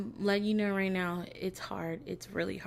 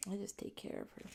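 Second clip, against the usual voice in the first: ordinary talk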